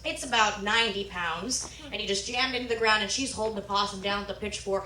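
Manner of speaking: normal talking voice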